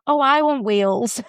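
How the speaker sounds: normal talking voice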